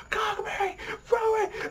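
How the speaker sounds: very high piercing call of the name; imitated scream. Fan reenacting Kuroko screaming at Kagami to throw, very high scream. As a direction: shriek at him to throw the ball. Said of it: "He's like, scream".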